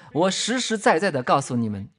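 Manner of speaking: normal talking voice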